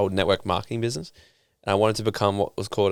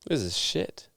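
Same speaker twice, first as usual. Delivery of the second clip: soft, deflated verdict; flat disappointed mutter; 7 dB quieter, slower than usual. Retold or imitated. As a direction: let the letdown sink in. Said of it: "I was like".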